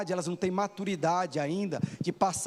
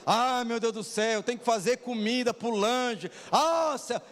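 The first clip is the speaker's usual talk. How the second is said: higher, slow, whiny put-upon sigh; mock grumbling; 5 semitones higher, slower than usual. Pastor mimicking a member annoyed at cooking: mock whiny grumble. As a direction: ham up someone complaining about making the snack.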